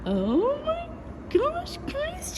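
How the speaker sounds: very high, slow squeal of delight; a real high-pitched oh my gosh; delighted gasp. Real, not acted. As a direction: gush at the first sight of the car. Car reveal.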